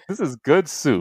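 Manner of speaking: mock announce the meme; hammed-up catchphrase voice. Meme catchphrase repeated by hosts.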